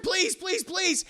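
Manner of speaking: high, repeated squeaky pleas; acted fright, pleading for life; mock panicked begging. Dwarf begs as guns point at him, mock panic.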